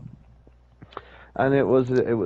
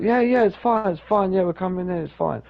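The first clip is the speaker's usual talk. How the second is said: high-pitched, hasty imitated reassurance; brushing-off reassurance; 7 semitones higher than usual. Companion at the sauna door insisting on entry, dismissive. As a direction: wave the worry away, starting high.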